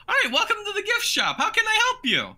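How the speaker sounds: very high-pitched cartoon shopkeeper; Nico the cat merchant. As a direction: greet customers in a piping cat-merchant voice. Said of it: "He goes".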